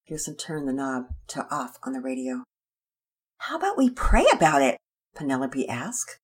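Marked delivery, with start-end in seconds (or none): 3.4-4.8 put-on girl asking. Girl's voice.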